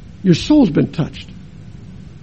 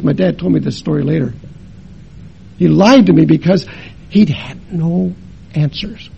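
ordinary talk, nothing marked

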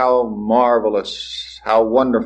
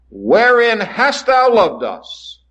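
higher, slow, cynical challenge; sarcastic question; 11 semitones higher, much slower than usual. Retold or imitated. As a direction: sneer the question at God. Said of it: "cynical, sarcastic inflection".

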